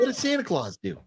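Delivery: put-on high comic Italian accent; Italian impression. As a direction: ask in a hammed-up, bewildered Italian voice. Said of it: "do your Italian impression".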